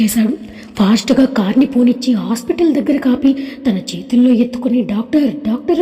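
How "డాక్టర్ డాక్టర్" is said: usual speaking voice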